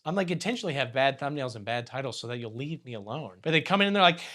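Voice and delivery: usual speaking voice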